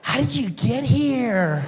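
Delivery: high, slow mock amazement; California surfer babe. Surfer babe questions South African visitor, high slow wonder. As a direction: drawl it like an amazed beach babe. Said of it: "California babe".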